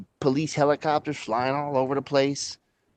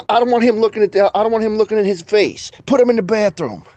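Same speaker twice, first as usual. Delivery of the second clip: high, louder mock order; imitated movie gangster; 7 semitones higher, 4 dB louder than usual. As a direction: bark it like a quoted mob boss. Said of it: "he goes".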